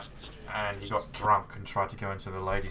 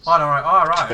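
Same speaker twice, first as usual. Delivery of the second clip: excited; 8 semitones higher, 15 dB louder than usual. Retold or imitated. Retold phone chat, excited reply.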